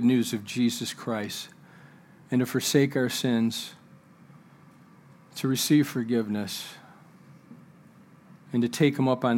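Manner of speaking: low, soft, measured preaching with long pauses; solemn sermon close. Real, not acted. Preacher urging the congregation to remember time, low and solemn. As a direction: drop low and soft, letting each thought settle.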